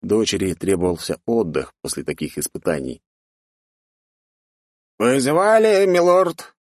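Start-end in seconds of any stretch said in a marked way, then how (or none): 5.0-6.5 high, drawn-out leisurely voice; old mage. A narrator's reading. Old mage enters lord's bedroom, leisurely high voice. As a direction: draw out the question as an unhurried old mage. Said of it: "Вальяжно в спальню к господину зашёл старый маг".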